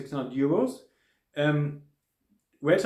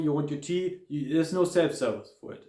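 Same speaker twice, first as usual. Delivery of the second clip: normal talking voice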